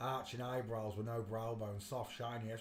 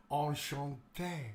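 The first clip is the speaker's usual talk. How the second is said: slow, drawn-out French greeting; imitated French accent, stretched out; much slower than usual. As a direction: put on a suave French accent. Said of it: "in a French accent".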